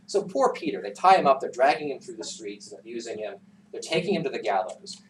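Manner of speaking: ordinary delivery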